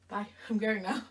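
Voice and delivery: ordinary talk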